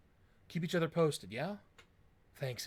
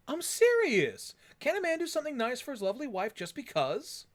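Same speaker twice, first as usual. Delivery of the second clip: mock indignation; 10 semitones higher, 4 dB louder than usual. [name] insists on innocence.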